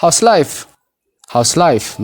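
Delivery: "House life" linked, how-is merged into one syllable; contracted model phrase. Shown as a demonstration. Teacher modelling for learners to repeat, contracted and linked. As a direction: run "House" straight into life, falling at the end. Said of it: "Mee na waliin jedhaa".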